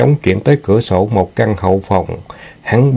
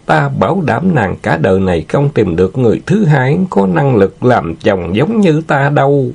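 higher-pitched, oily boasting; lecherous villain, gloating; 6 semitones higher than usual. Acted. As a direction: gloat over a captive in a raised, leering voice.

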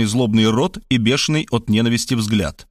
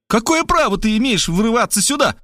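put-on high girlish indignant voice; 9 semitones higher than usual. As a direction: challenge the intruder, high. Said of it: "возмущалась Варвара". (высокий возмущённый девичий голос)

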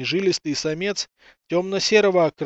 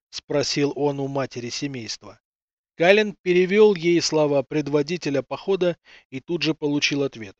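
plain reading voice

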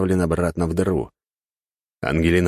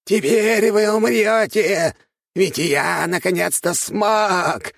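high, slow, gloating monster voice; triumphant squeaky villain; gloating rat king; 14 semitones higher, much slower than usual. Acted. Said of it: "расхохотался король крыс".

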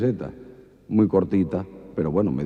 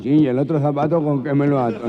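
higher, louder comic indignation; indignant gangoso; 6 semitones higher, 6 dB louder than usual. Acted. Gangoso boyfriend retorting to his girlfriend, mock indignant. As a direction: fire it back indignantly.

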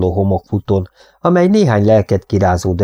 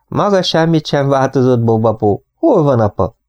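put-on eager young woman; 6 semitones higher than usual. Molly greets Bob, asks father.

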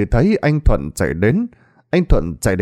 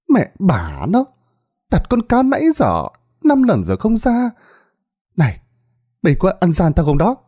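angry outburst; 7 semitones higher than usual. Acted. Bà Sáu angry at the dealer.

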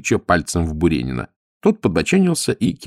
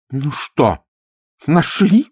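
high-pitched, agitated question; worried, higher voice, pressing for news; anxious exclamation; 7 semitones higher, 3 dB louder, much slower than usual. A narrator's reading. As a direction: ask urgently, full of nerves. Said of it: "воскликнула она, волнуясь".

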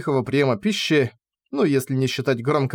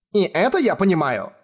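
shouted; 5 semitones higher than usual. A narrator's reading. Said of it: "выкрикнула я".

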